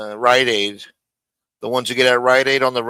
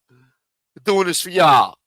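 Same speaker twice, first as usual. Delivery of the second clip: slow mocking drawl; 4 semitones higher, 4 dB louder, slower than usual. Stretching the mocked catchphrase.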